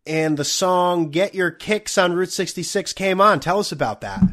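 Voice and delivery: slow, drawn-out quiz host reading a contestant's anecdote; mock game-show host. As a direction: feign interest like a host stuck with a dull story. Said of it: "it must kill Alex Trebek and, and, uh, Pat Sajak to have to ask".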